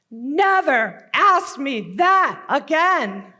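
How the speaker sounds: slow, loud, hard-stressed scolding; stern, forceful and drawn out; angry father's command. Acted. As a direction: lay down the law to a child, word by word. Father snaps after whacking child.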